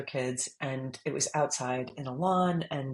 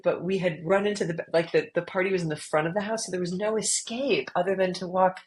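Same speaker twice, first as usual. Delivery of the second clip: ordinary delivery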